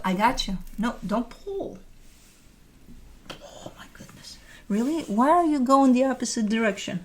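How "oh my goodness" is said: breathy whispered oh my goodness; whispered exclamation. Real, not acted. Straining to lift her dog, whispered exclamation.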